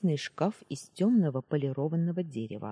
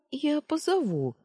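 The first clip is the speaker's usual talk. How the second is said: eager maid; 12 semitones higher, 3 dB louder than usual. Acted. Maid hurries off.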